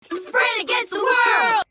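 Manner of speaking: high, slow, drawn-out rally cry; triumphant stretched-out shout; shouted slogan. Acted. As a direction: proclaim it like a team motto. Chants the duo's slogan.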